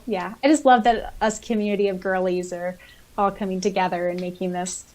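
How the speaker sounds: normal talking voice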